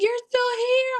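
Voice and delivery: high surprised "You're still here?"; surprised nurse. Retold or imitated. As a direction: mimic the startled nurse, pitched high. Nurse surprised to see her.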